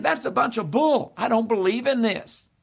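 a scornful unbeliever brushing it all off; mocking scoff. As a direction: toss it off as a sneering skeptic. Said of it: "He's saying".

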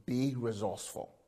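normal talking voice

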